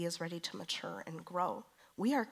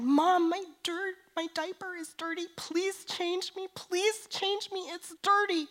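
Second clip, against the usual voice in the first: imitating crying toddler; 13 semitones higher, 8 dB louder than usual. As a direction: whimper it like a small child begging. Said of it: "she was crying and crying because she said".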